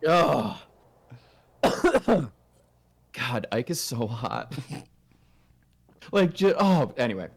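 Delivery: ordinary talk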